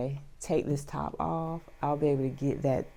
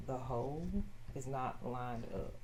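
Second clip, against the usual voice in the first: usual speaking voice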